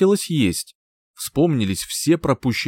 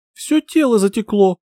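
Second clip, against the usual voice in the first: groaning complaint; 10 semitones higher, 3 dB louder than usual. Acted. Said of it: "пожаловалась, застонав".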